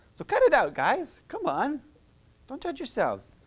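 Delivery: high, loud mock scolding; teacher urging the group to stop self-judging; playful scolding. Real, not acted. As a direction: wag a finger at the crowd.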